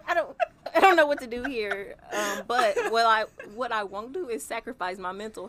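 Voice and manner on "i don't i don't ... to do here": laughing helplessness. Retold or imitated. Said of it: "It's like".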